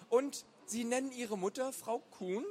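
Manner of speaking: put-on high-pitched, probing kid's question; high boyish voice, catching someone out; suspicious boy. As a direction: question it as a sceptical young boy. Boy grows suspicious of Peer.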